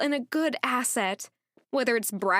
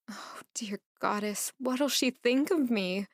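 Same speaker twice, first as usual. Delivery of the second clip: plain reading voice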